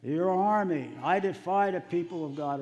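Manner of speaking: slow, high, loud defiant bellow; the giant shouting his defiance at the army of Israel; giant's yell. Retold or imitated. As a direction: roar the challenge across the valley like a taunting giant. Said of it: "he yells out to them".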